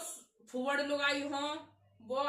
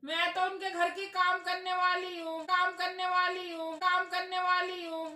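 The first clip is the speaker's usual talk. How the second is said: higher, louder put-on maid insisting; hammed-up servant voice, higher and louder; pretend maid voice; 5 semitones higher, 4 dB louder than usual. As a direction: keep repeating that you're the maid, in a joking voice. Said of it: "मैं मजाक कर रही".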